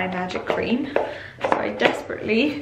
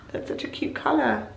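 hushed adoring gasp; gasped whisper; 5 semitones higher, 7 dB quieter than usual. Real, not acted. Vlogger admiring a cute colour, whispering. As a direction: whisper it in delight.

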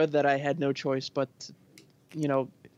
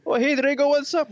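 high, louder mimicked hello; excited greeting; 11 semitones higher, 5 dB louder than usual. Teasing a friend about arriving at parties, excited greeting. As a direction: imitate an over-eager friend, pitched way up.

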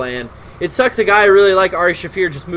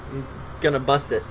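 ordinary talk, nothing marked

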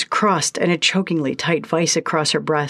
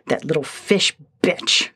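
through clenched teeth. A narrator's reading. Lindsay spitting her rival's name through clenched teeth. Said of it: "she said through clenched teeth".

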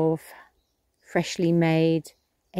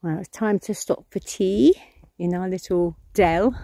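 normal talking voice

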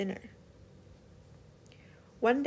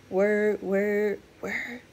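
whirring propeller; 4 dB louder, much slower than usual. Acted. Propeller sound.

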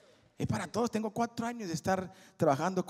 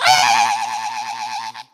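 loud sudden yell from the crowd; a sharp shout out of nowhere; sudden scream; 3 semitones lower, 16 dB louder than usual. Retold or imitated. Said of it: "Se oyó un grito tan fuerte". (grito repentino y fuerte)

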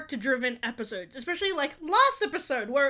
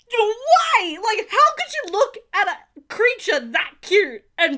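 high, loud, indignant squeal at the villain; outraged squeal; 8 semitones higher, 8 dB louder, slower than usual. Retold or imitated. Fan shrieking at Moff Gideon's cruelty, outraged. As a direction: protest in disbelief.

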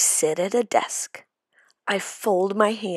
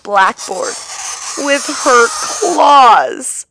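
dramatic; 6 semitones higher, 7 dB louder than usual. A narrator's reading. Describing monster teacher dramatically.

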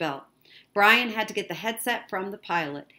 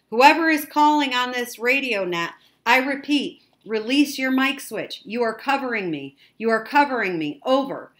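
loud, raised radio operator voice; higher, louder transmission to an unknown caller; 6 semitones higher, 8 dB louder than usual. A narrator's reading. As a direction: project firmly over a static-filled radio link. Radio operator calls unknown sender.

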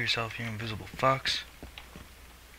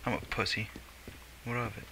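usual speaking voice

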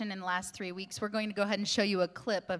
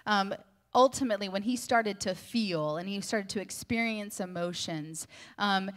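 ordinary delivery

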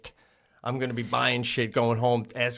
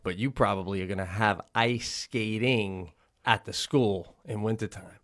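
usual speaking voice

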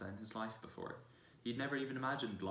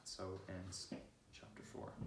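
soft chapter close; 3 semitones lower, 9 dB quieter, slower than usual. Real, not acted.

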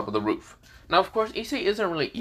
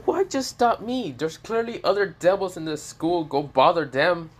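whiny complaint; 7 semitones higher than usual. Acted. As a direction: whine it up high, feeling singled out. Character complains at being singled out.

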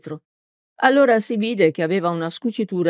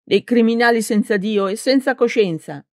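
high-pitched, vehement comeback; fervent accusation in a narrator's high girl voice; heated retort; 7 semitones higher than usual. A narrator's reading. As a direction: fire the insults back at a raised pitch. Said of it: "ribatté con foga la ragazza".

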